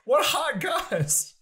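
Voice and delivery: high, slow gossipy drawl; excited gossip. Real, not acted. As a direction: stretch it out like juicy gossip. Playful gossip reaction.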